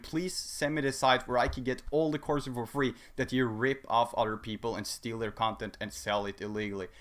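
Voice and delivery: ordinary delivery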